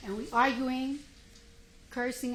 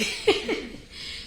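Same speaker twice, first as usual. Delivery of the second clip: 1 high breathy laugh; 10 semitones higher than usual. Real, not acted. Laughter at own story.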